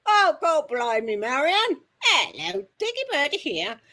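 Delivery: high, loud Cockney gent. Retold or imitated. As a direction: burst out in broad Cockney, imitating an East Ender. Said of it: "his cockney came flying out of him".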